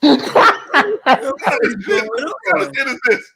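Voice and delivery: high, helpless laughing disbelief; real incredulous laughter. Real, not acted. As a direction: squeeze the words out through laughter. Cracking up at the calamine line.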